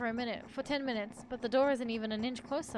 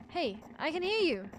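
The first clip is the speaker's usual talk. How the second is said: higher-pitched distant call; 6 semitones higher, 3 dB louder than usual. A narrator's reading. Voice behind the door calls to the trapped narrator, raised and lightly projected. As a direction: call across to someone unseen.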